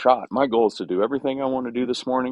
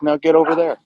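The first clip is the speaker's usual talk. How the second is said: a lightly voiced pushy mare, higher and louder; bossy lead mare; 7 semitones higher, 5 dB louder than usual. Lead mare ordering another horse off, mock bossy voice. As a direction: bark the order like the boss mare shoving a horse aside. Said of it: "be like".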